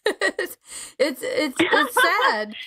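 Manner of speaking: slow, tearful and high; real tearful catch. Real, not acted. Host moved by the guest's abuse story, slow and tearful. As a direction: let the sadness catch in your throat.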